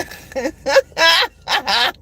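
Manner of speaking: high laugh. Real, not acted. Laughs at her own line.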